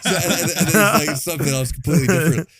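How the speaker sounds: talking through laughter; high, loud laughing words. Real, not acted. Cracks up after interruption.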